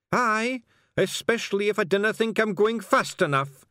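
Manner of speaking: high, loud Scots warning; threatening Scottish stranger. Acted. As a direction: make a pointed threat in high, broad Scots.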